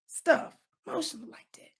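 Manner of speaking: soft unvoiced whisper; whispered jab at the brothers; whispered aside. Real, not acted. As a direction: drop to a whisper as if sharing a secret.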